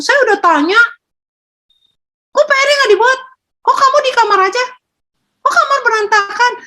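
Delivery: very high, scolding nag, quick on the room line; nagging parent. Retold or imitated. Parent nagging a teen about homework and a messy room, in a high scolding voice. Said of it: "banyak orang tua bilang".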